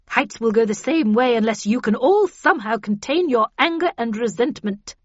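loud, forceful lecture; cold, stern warning. A narrator's reading. Havisham warns the characters to contain their anger, sternly. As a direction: lecture the group severely, stressing each danger. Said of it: "said Havisham coldly".

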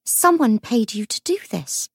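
usual speaking voice

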